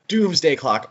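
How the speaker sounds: ordinary talk, nothing marked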